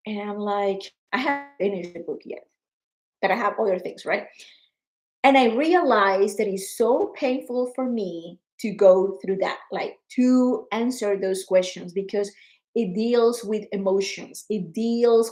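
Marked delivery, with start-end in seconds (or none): none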